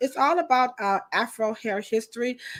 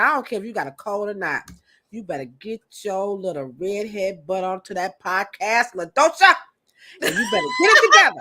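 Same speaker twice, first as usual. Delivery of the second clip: imitating her boss. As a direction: boss scolding her, building to a shout. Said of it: "She said".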